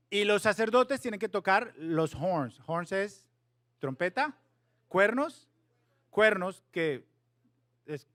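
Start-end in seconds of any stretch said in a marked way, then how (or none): none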